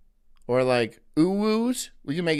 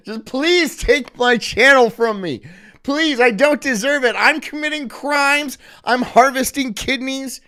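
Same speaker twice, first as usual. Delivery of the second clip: mock pleading; 7 semitones higher, 7 dB louder than usual. Joking plea to lose channel.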